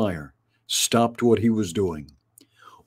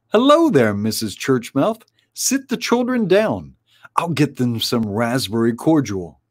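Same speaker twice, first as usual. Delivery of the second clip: bustling helper; 2 semitones higher than usual. Acted. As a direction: greet a family warmly at the door. Helper greeting guests at feast.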